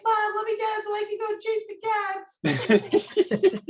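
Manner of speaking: very high-pitched pretend dog voice; squeaky talking pet pleading with its owner; Jovi. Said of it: "with a human high-pitched voice".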